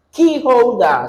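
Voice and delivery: "Key holder" with KEY pitched high, then falling; teacher's demo of "Key holder", first word stressed. Shown as a demonstration. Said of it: "stress key".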